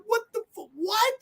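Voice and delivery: very high, incredulous squeak; baffled disbelief. Retold or imitated. Viewer reacting to a terrible deleted scene, baffled. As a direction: cut yourself off in high-pitched disbelief.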